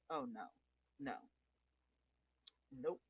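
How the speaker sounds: ordinary talk, nothing marked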